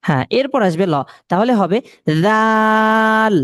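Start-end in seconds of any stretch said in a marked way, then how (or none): 2.2-3.4 জাল with the a held very long, slowly; long drawn vowel. Shown as a demonstration. Teacher stretches the vowel for students. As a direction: draw out the জা of জাল. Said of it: "এক আলিফ টান দিয়ে বলতে হবে".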